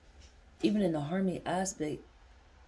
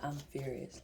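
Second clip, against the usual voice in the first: quiet intensity; 4 semitones lower, 9 dB quieter than usual. Real, not acted. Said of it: "I'm not even mad".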